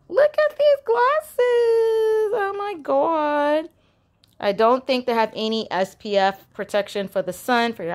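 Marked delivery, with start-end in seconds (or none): 0.1-3.7 much higher, slow, gushing over the glasses; excited squeal. Real, not acted. Vlogger showing viewers cute glasses, high excited squeal. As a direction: squeal with delight at something adorable.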